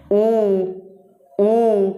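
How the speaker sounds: teacher sounding out a long rounded ऊ; vowel demo. Shown as a demonstration. Teacher shows students the vowel, long rounded ऊ. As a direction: say ऊ slowly with lips rounded. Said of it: "बोल के देखो".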